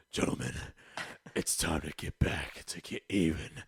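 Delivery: low, gravelly Batman rally; rally the troops in a deep hero voice. Retold or imitated. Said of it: "you had to do it in the voice".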